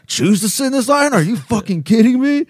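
high, loud incredulous outburst; imitating a livid customer blowing up. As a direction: shriek it in mock outrage. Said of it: "that's when he got livid".

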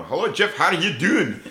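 put-on exuberant greeting; imitated hearty greeting. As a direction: call out a hello as the scruffy guy. Scruffy man greets him, voiced.

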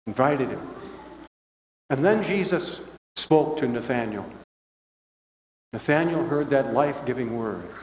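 usual speaking voice

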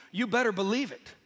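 ordinary talk

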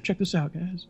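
high-pitched imitation show-off; mock showing off. Actor showing off to his crew, high mock boast. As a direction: call for attention, pitched well up.

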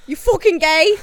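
loud, high hammed-up British jeer; over-the-top British taunt; mock British accent. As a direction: tease in a fake British voice. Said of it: "That's not Jersey".

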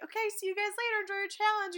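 high, fast hurried goodbye; fast mock dismissal; rushed brush-off. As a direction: rattle it off quickly. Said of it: "And then they're like".

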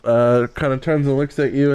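normal talking voice

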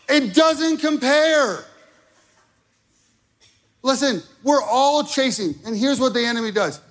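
high, loud and driving, building to a shout; fiery preaching. Acted. Pastor rousing the congregation against the enemy, fiery shouting preach. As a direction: hammer it home to the congregation.

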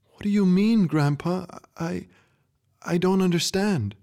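pleading to understand, voice breaking high; distressed girl. Acted.

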